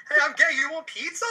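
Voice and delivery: goofy Peter Parker. Retold or imitated. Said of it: "Peter Parker just being like".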